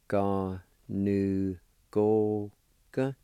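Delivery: "ga, nu, go, ga" one syllable at a time; sounded out. Shown as a demonstration. Teacher giving the third part of the word, sounded out. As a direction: separate each of "ga, nu, go, ga" with a beat. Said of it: "Third part".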